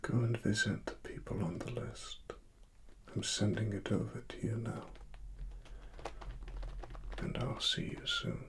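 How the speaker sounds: normal talking voice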